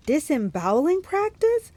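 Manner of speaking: slow, high, rising disbelief; drawn-out astonished query; incredulous question. A narrator's reading. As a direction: stretch it into an alarmed question. Annabeth asks in disbelief.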